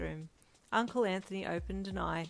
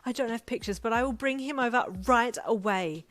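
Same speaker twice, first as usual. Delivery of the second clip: high, loud, emphatic eagerness; excited promise; 4 semitones higher, 8 dB louder than usual. A narrator's reading. Friend promises to bring the puppy over, excited. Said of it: "still jumping up and down".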